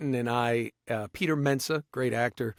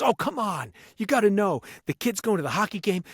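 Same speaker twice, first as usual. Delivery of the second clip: high-pitched, animated urging; egging someone on, pitched up and eager; animated director impression; 7 semitones higher than usual. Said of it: "and, man, he talks like that".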